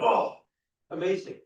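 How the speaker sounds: loud, high burst of delight; thrilled gasp. Acted. Audience member at the film's climax, loud thrilled delight. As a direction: gasp it out like the hero just won. Acting the thrill when hero wins.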